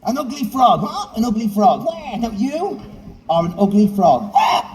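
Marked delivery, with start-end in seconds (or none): none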